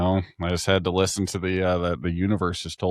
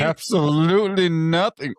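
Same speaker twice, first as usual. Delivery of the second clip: slow, drawn-out deadpan; deadpan echo; 7 semitones higher, slower than usual. Acted. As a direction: drag it out mockingly. Echoes slowly.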